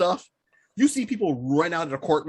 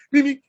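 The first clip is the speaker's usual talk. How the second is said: high, loud beep-beep; Roadrunner impression; 6 semitones higher, 6 dB louder than usual. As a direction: chirp it like the cartoon Roadrunner. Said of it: "took off like the Roadrunner".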